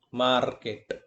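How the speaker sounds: "Market" with a drawn-out MAR; teacher's demo of "Market", first syllable stressed. Shown as a demonstration. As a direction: lean on MAR in "Market", let KET drop. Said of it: "the trace is on the first syllable".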